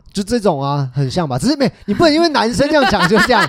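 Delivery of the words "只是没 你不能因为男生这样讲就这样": high laughing protest; giggling protest. Real, not acted. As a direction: laugh through the words. Laughing while arguing.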